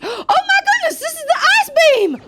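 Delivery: very high, loud excited squeal; thrilled outburst. Real, not acted. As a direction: gasp and shriek with delight. Thrilled at finding ice beam.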